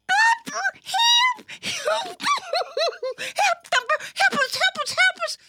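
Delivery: squealing, very high panicked little voice; crying for help. A narrator's reading. Little sister calling her brother for rescue, panicked squeals. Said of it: "cried one of the sisters".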